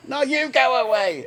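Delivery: high, slow, mocking Cockney retort; Cockney accent. The rude bloke tells Tim to go away, in a slow Cockney accent. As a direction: throw it back in a cheeky Cockney drawl. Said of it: "changing his accent to".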